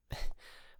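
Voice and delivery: quick unvoiced embarrassed giggle; airy little laugh; breathy giggle. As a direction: giggle under the breath, a bit sheepish. Embarrassed about being rich.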